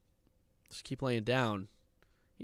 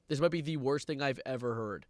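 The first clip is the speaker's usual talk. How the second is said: ordinary talk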